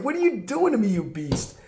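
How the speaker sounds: a hint of a wronged little boy; outraged little kid. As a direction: play the betrayed four-year-old.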